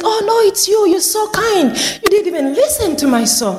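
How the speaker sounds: put-on high, gushing old-lady quote; grateful old lady. Old resident deflecting praise, grateful.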